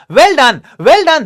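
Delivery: high, loud and slow praise; encouraging. Acted. Teacher cheering the stammering girl, encouraging. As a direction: cheer her on like a proud teacher. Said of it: "the teacher encouraged her".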